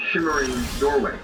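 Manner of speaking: normal talking voice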